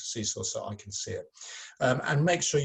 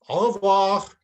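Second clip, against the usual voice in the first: higher, louder puppet goodbye; 8 semitones higher, 9 dB louder, slower than usual. Acted.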